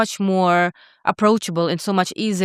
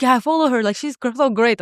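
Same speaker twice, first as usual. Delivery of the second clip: excited fan gush; 5 semitones higher than usual. Retold or imitated. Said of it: "She's like".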